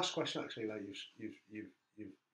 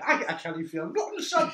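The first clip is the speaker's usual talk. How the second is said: loud, high Geordie dad; Geordie accent; 7 semitones higher, 8 dB louder than usual. Retold or imitated. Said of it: "if you imagine this in a Geordie accent".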